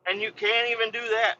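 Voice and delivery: high, exasperated retold rant; mad disbelief. Boss ranting about lazy workers as he drives off, angry. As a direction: vent incredulous anger, pitch pushed way up. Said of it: "They can tell I'm mad".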